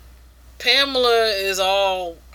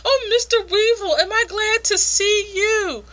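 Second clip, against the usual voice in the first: hammed-up delight, pitched way up and slowing; gushing midwife; 11 semitones higher than usual. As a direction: gush in a high put-on lady voice. Midwife gushing at weevil's arrival.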